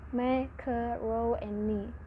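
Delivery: "me, ke, ro, and ni" spaced out one syllable at a time. Shown as a demonstration. Student teaching the class, splitting "me, ke, ro, and ni" into separate syllables. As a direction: break macaroni into slow separate beats. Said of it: "Macaroni has four syllables".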